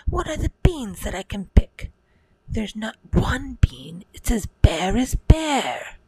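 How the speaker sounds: high, scolding mum voice; exasperated mother. Acted. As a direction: snap it at the silly boy. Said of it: "The mother said".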